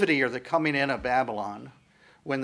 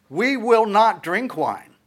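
louder, slow, emphatic refusal; firm refusal; 8 semitones higher, 6 dB louder, slower than usual. Acted. Rechabites refusing Jeremiah's wine, loud and firm. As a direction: plant your feet and refuse.

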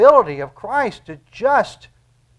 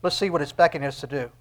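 ordinary talk

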